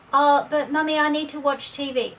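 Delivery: put-on high, loud little-kid whine; whining child. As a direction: nag for dessert right now. Child pleads against parent.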